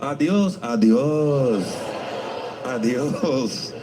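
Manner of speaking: adios in a light mock Japanese accent; Spanish in a Japanese accent. Comic leaving the Japanese restaurant, mock Japanese accent. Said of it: "Spanish in a Japanese accent".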